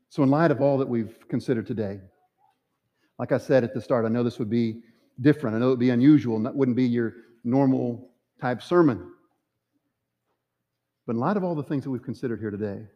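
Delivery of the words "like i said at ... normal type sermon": usual speaking voice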